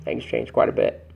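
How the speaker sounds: whispered repeat of the phrase; hushed whispered echo. Shown as a demonstration. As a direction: drop to a breathy whisper for emphasis.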